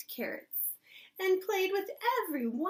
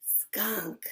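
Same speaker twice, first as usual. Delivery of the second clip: breathy whispered skunk; whispered reveal; 4 semitones lower than usual. Acted. Teacher whispering the reveal to children. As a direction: whisper it like a secret.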